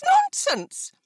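very high, falling, final dismissal; emphatic mother. A narrator's reading. Wife dismissing husband, very high and emphatic. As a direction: slam the second one down. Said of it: "Missus Bennet said only".

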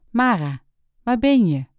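a calling search. Acted. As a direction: call out searching for her. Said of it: "riep hij".

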